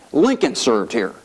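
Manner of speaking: slow, marvelling at LINCOLN; retold wonder, savour the name slowly; Sonny in awe. Congressman showing his colleague the chamber, awed. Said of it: "he was just in awe of the institution".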